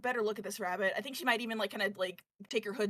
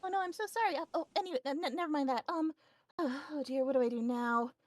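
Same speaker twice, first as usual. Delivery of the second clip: higher, nervous and apologetic; higher rabbit girl, fretting over what to do; flustered rabbit girl; 5 semitones higher than usual. Acted. GM voices Minty the rabbit.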